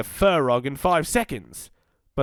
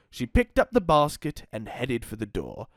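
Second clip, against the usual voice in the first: usual speaking voice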